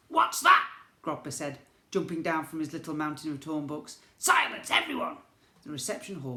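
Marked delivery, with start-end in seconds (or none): 0.1-0.7 high, loud, startled goblin squeak. A narrator's reading. Goblin prince hearing the seafront noise, high and startled. 4.2-5.2 high, loud goblin order; goblin command. A narrator's reading. Goblin prince silencing the crowd, high loud command.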